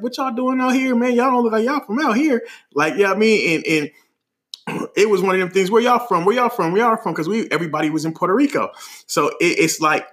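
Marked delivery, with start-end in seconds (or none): 0.0-2.4 high-pitched chatty stranger; curious tourists. Retold or imitated. Tourists chatting up strangers in line, curious high voice. 5.7-7.1 high, repeated eager questioning; curious tourists. Retold or imitated. Tourists meeting each other abroad, curious high voice. Retelling tourists asking around.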